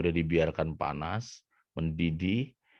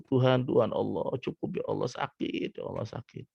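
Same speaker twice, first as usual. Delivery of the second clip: whimpered plea fading to a whisper; hammed-up weeping, losing voice as it goes; mock crying; 5 semitones higher than usual. Coconut begs God for mercy in pain, mock crying. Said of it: "Dan dia nangis gitu".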